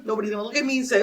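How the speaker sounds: usual speaking voice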